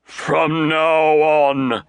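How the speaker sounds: slow, pompous pronouncement; pompous uncle announcing; grandiloquent. A narrator's reading. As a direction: drag it out grandly. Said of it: "he announced grandiloquently".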